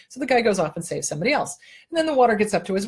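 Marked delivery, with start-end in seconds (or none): none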